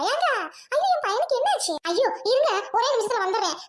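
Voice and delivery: mother panics at news of her son; panicked wail. Acted. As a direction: burst out in alarm for her son. Mother hears son fainted.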